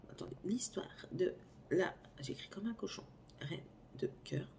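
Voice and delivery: hushed whisper to herself while writing; breathy murmur under her breath; whispered aside. Real, not acted. Busy writing the title, she whispers to herself.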